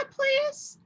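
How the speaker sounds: put-on high, pleading little-girl "please"; high childlike plea; pleading child. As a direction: beg Mama sweetly like a child. Esperanza begs to keep kitten.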